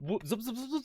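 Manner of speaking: quick, high-pitched zipping sounds; imitated zipping noise. Game master retelling the monster's climb, quick high zipping noises. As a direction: make a rapid, high cartoon-like zip.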